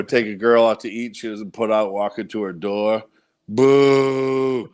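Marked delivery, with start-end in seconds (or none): 3.5-4.7 long drawn-out mock boo; retold boo. Comic demonstrating his joke about bad dates, long mock boo. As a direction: hold the boo on one note. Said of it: "boo things that suck in the world".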